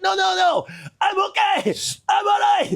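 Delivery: panicked protest. Retold or imitated. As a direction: squeak out a frantic denial. Panicked denial to Jerry Hall.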